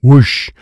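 loud, high whoosh noise. A narrator's reading. Narrator as cards jump from his hands, loud whoosh.

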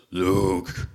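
low, lightly put-on Darth Vader voice; James Earl Jones voice. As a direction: drop low and slow into a mock Vader rumble. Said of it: "like, James Earl Jones voice".

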